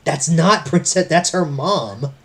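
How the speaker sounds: loud, slow, drawn-out correction; exasperated, loud setting-straight; emphatic correction. Real, not acted. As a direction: correct them loudly, stretching the words.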